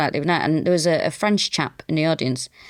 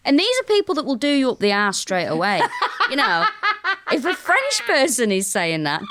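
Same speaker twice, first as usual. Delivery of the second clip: laughing while talking; giggling through the punchline; 7 semitones higher than usual. Real, not acted.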